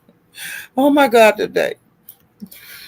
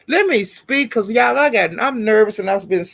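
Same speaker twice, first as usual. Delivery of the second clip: usual speaking voice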